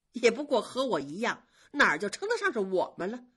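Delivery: mock her rival's words with cold scorn; envious maid lashing out with sarcasm; jealous sneer. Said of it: "冷笑了几声说".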